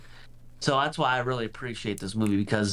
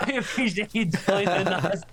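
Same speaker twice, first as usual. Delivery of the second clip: higher, laughing through the thanks; 7 semitones higher than usual. Real, not acted. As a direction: thank listeners while still laughing.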